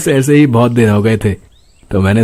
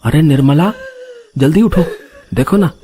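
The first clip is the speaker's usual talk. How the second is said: higher, urgent waking call; urgent fear; 5 semitones higher than usual. Acted. Husband urgently waking his wife Nirmala. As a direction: shake her awake in panic.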